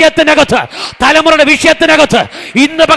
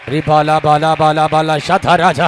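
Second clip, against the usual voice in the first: low, soft, slow tongues, sinking down; deep prayer in tongues; 10 semitones lower, 4 dB quieter, slower than usual. Real, not acted. Preacher sinking into prayer before the congregation, low slow tongues. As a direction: drop the voice low and let the syllables drift slowly.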